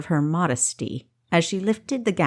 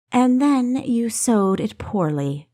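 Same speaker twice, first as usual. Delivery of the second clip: slow, cold, lightly voiced rebuke; a doctor scolding a patient, unsparing; merciless; 5 semitones higher, slower than usual. A narrator's reading. Doctor chides Kessa for her sloppy stitching, mild merciless rebuke. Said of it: "Finesse declared mercilessly".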